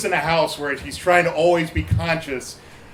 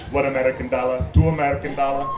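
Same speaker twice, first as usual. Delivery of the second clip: lower, careful counting in a put-on Ugandan accent; mock African accent; 4 semitones lower, faster than usual. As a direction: count out change as a foreign student with a Ugandan accent. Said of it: "doing this whole Yamol thing".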